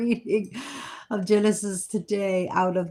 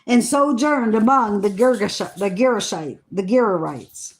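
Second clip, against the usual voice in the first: measured, solemn Bible reading voice; scripture reading; 4 dB louder than usual. Acted. Reading Genesis to her listeners, in a solemn reading voice. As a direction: read the old words with weight and care.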